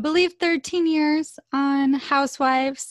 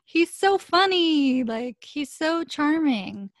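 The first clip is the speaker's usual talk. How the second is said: gushing fan; 6 semitones higher, 4 dB louder, slower than usual. Retold or imitated. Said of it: "people love to be like".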